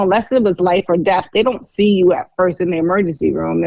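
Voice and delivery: ordinary delivery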